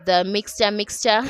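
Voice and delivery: ordinary talk, nothing marked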